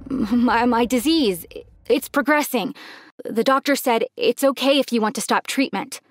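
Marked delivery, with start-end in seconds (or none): 0.1-2.7 halting stammer with repeated first words; stuttering. Acted. Dad answers his daughter's question about treatment, stuttering. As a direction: stumble and pause, searching for an answer. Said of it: "He stuttered while trying to answer".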